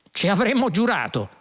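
understated yell, pitched high. A narrator's reading. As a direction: call back with a mild shout. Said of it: "urlò Ford".

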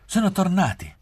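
high, loud joyful cry; excited exclaiming. A narrator's reading. Gabriella jumps up as the car arrives, exclaiming joyfully. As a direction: burst out with delight at the news.

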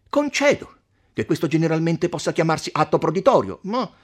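animated, insistent rebuttal; argumentative count. Acted. Count appealing to the silent doctor, argumentative. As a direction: build the argument with scornful quotations. Voices count Attilio arguing.

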